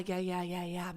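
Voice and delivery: normal talking voice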